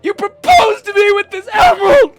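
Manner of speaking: slow, high, very loud wail; hammed-up heartbroken rage; anguished shouting. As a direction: scream betrayal while striking. Acted violent break-up.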